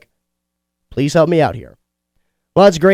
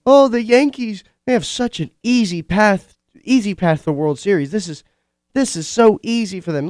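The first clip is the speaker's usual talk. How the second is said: mocking whiner; 6 semitones higher than usual. Mocking online complainers.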